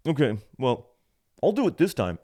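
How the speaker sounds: high, loud put-on clerk voice; grudging clerk. Clerk accepting the return, mock grudging. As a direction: mimic a clerk conceding as a favor.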